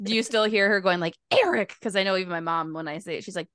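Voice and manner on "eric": high-pitched mock mom calling a name; imitated scolding mom. Asking if he still hears his mom, imitated maternal scolding. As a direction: call out the name like an exasperated mother.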